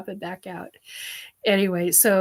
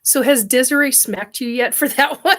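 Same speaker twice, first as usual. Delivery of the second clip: louder, laughing question; laughing tease; 5 semitones higher, 6 dB louder than usual. Real, not acted. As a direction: tease him through laughter. Teasing Derek while laughing.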